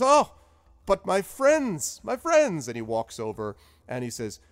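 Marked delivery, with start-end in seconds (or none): 0.0-2.7 high, loud, slow welcome; delighted wizard greeting. Acted. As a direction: open your arms to old friends. Zephyros greeting party.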